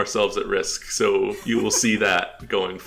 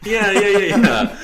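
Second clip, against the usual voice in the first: much higher and louder, eager agreement; emphatic yeah; 11 semitones higher, 9 dB louder than usual. Real, not acted. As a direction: fire off the yeahs eagerly. Agreeing about avoiding lawsuits.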